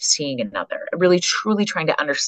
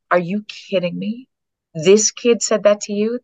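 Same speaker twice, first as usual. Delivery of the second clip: slow, higher amazed disbelief; surprised; 6 semitones higher than usual. Retold or imitated. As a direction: ask it slowly, amazed. Surprised reaction retold.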